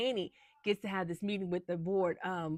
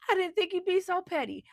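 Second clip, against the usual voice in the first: offended retort; 10 semitones higher, 6 dB louder than usual. Retold or imitated. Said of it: "And she's like".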